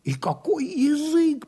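slow, high-pitched marvelling; exaggerated, lingering praise; gushing admiration. A narrator's reading. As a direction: draw it out high in awe. Admirers gush about the fool.

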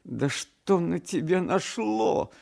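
high, drawn-out wailing question; anguished mother, pitch way up; distraught mother. A narrator's reading. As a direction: ask in dismay, slowly and high. Distraught mother questions her son.

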